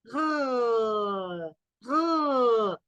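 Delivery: long, drawn-out French R syllable; French R demo. Shown as a demonstration. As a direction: hold the French "r" sound slowly. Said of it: "Luego dice la R como en". ("r" francesa alargada)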